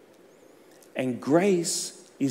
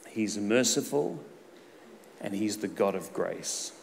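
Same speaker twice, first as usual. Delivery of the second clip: soft, slow, hushed praise; reverent preaching; 4 semitones lower, 5 dB quieter, slower than usual. Acted. Preacher to church on God's mercy, hushed reverent intoning. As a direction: let each word of God's nature sink in.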